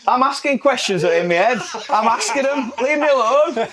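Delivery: high-pitched, laughing self-defence; jokey plea to be left alone; mock protest. Teased host mock-protests over his question.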